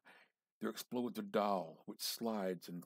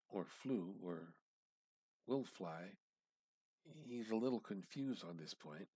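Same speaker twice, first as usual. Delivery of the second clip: usual speaking voice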